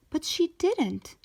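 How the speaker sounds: ordinary delivery, nothing marked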